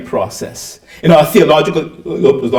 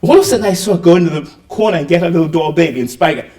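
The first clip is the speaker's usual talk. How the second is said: normal talking voice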